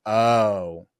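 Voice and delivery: normal talking voice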